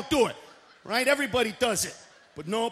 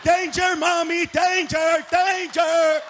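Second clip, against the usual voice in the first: loud, higher mock cry of danger; mock alarm shout; 3 semitones higher, 6 dB louder, much slower than usual. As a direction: yell it like a panicked kid calling mommy. Handicapped friend yells alarm.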